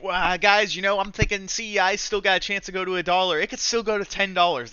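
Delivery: mocking stock pumper. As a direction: ham up a shill reassuring his followers. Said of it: "They would be like".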